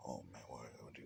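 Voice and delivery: breathless scared whisper; hushed, worried whisper to himself; panicked whisper. Acted. Deku panicking, surrounded by engineers.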